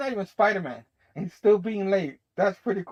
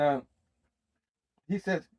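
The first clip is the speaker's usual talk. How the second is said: ordinary delivery, nothing marked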